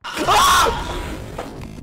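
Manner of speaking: sudden high breathy scream; startled scream. Real, not acted. Gamer hit by a jump scare, screaming. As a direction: shriek in fright.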